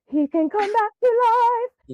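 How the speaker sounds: very high, loud mock-excited squeal; excited revelation. Replaying her business idea for Stony Pony, excited revelation. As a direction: exclaim it like a thrilling brainwave.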